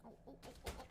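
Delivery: very soft cooing. Real, not acted. Streamer cooing quietly over the cat, soft coo.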